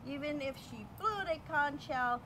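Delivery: ordinary delivery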